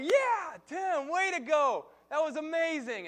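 put-on high, loud, slow kid's cheer; excited cheer. Little boy cheering his big brother for the punch, excited. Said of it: "I'm like".

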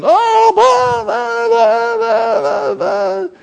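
hammed-up toddler tantrum; fussing child. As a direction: imitate a worked-up kid crying over nothing. Child worked up, mock fussing.

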